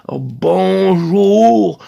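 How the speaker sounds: bonjour drawn out slowly, each syllable bon-j-our clear and loud; overarticulated. Shown as a demonstration. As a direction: pronounce bonjour slowly and carefully. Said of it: "bien prononcer le bon, le j, le our".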